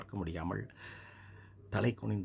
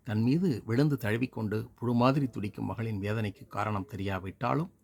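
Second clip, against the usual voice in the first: ordinary delivery, nothing marked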